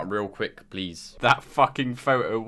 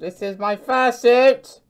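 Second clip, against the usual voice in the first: proud announcement; 8 semitones higher, 4 dB louder, slower than usual. Pretend. Showing off fursuit in call.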